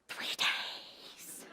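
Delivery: whispered, drawn-out repeat; emphatic whisper. Acted. Mother stressing how long her labor lasted, emphatic whisper.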